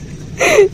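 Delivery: very high, brief laugh; short giggle. Real, not acted. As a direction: let out a quick high giggle. Laughing at the drawn lips.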